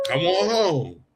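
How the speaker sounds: playfully telling Clint to come home; teasing playful call. Retold or imitated. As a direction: coax him like you've got him. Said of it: "And I said".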